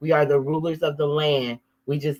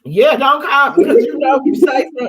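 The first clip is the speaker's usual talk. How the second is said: mock loud protest; 10 semitones higher, 10 dB louder than usual. To her siblings, a loud high mock plea. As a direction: throw it up loud and high, pretending. Friends mock family calling for help.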